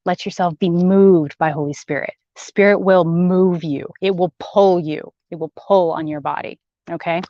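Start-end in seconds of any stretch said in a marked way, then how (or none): none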